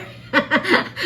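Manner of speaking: a real burst of high breathy laughter; bright high laugh. Real, not acted. Cook laughing at the end of her grandma story, high laugh. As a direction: laugh out loud at your own punchline.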